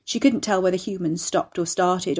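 ordinary delivery

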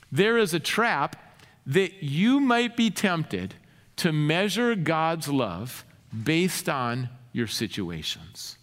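ordinary talk

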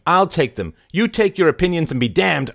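high, loud outburst of temper; angry snap. A narrator's reading. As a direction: round on him furiously. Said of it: "she spun on him".

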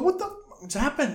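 high-pitched alarmed question; mock shocked friends. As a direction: imitate baffled friends, voice pitched right up. Acted shocked friends of the victim.

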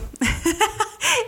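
high, short laugh. Real, not acted. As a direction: burst of high-pitched laughter. Laughs after "it's you.

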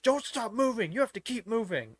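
shrill-pitched mock order, yelled out; yelling. Ride worker to guests stopping for pictures, yelling. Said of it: "Like, yelling at us".

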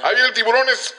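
sudden high shout. Acted. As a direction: yell a warning to the actor. Producer cues the actor.

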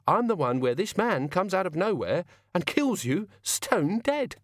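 high villain voice, rising at the end; cheerful killer. Acted.

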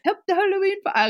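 high, fast Irish lilt; Irish accent. Shown as a demonstration. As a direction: attempt an Irish brogue. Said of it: "in an Irish accent".

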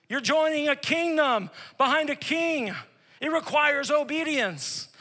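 fiery pulpit proclamation; preaching at full force. Acted. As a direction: preach it hard and high.

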